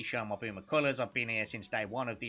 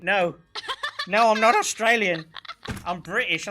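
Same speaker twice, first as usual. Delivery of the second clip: loud, raised-pitch mock indignation; exasperated protest; 7 semitones higher, 11 dB louder than usual. British visitor correcting strangers, loud mock exasperation. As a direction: protest loudly, retelling the outrage. Retold protest at being called Australian.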